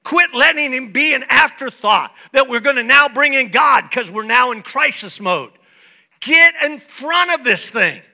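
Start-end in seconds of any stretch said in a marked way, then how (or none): none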